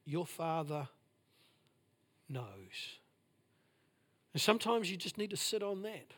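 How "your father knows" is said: dropping to a near-hush, slow and gentle; hushed tender. Acted. Preacher softly assuring the church of God's care. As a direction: lay it down softly like a comfort.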